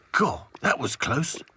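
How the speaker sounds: slow, relieved character voice; relieved doll. A narrator's reading. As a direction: let out the tension slowly. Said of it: "said Back to Front".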